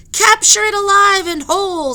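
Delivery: high, very loud zeal; eager command. Acted. Professor tells the captain, high, very loud and eager. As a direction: demand it with greedy zeal. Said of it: "said the professor".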